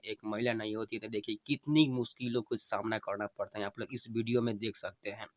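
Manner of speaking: usual speaking voice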